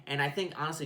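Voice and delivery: ordinary talk, nothing marked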